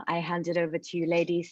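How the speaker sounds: ordinary delivery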